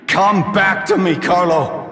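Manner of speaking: very high, strained anguished cry; a father's high-pitched wail calling his son home; desperate plea. Acted. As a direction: beg him back with everything you have.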